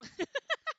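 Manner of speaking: high loud burst of laughter; real laugh; big laugh. Real, not acted. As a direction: crack up at the question. Laughs at chat question.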